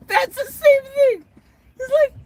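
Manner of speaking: high, squealing laughter through the words; incredulous laughing. Real, not acted. Man amazed at the casual message, laughing incredulously. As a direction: crack up while talking.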